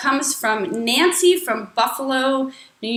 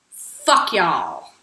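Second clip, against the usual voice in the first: demo line; 6 semitones lower than usual. Shown as a demonstration. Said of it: "just practice saying".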